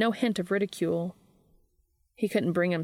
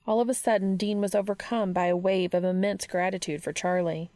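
usual speaking voice

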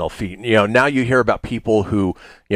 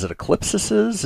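joking; 11 semitones higher than usual. Acted. Joking about the plural.

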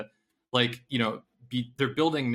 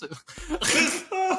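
bursting laugh. Real, not acted. Laughing at own accent.

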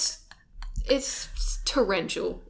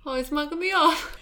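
higher mock Essex slang; Essex accent; 7 semitones higher, 4 dB louder than usual. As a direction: play a Towie girl. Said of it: "shocking accents there".

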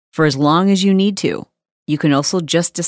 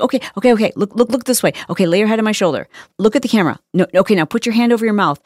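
higher, hurried mock stage-mom directions; coaching mother; 6 semitones higher, much faster than usual. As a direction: imitate a fussing mom posing her child. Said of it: "she is coaching her son".

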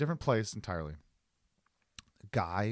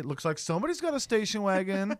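mock comeback; 6 semitones higher, faster than usual. Imagined reply mocking the stranger, higher singsong echo. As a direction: throw his catchphrase back at him. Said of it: "Or I should say".

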